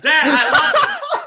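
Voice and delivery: high, loud, delighted cheering; excited approval. Real, not acted. Host cheering a fan's bold score prediction, excited. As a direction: cheer them on, voice jumping up into a laugh.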